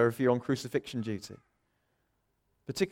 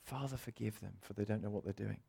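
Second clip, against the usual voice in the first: much softer, almost under the breath; soft repeat; 5 semitones lower, 13 dB quieter than usual. Shown as a demonstration. Preacher repeating the words to the congregation, much softer. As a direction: let it sink in quietly. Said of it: "repeated quietly".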